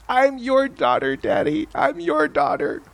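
put-on high, small voice of a girl in tears; lightly voiced daughter answering through tears; tearful little girl. Daughter answering her father, in a high, small tearful voice. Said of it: "with tears streaming down her face".

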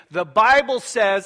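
preachy scold. Acted. Imitates verse-throwing church scold.

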